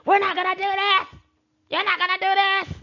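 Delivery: imitated high-pitched, loud fussing. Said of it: "raised her voice".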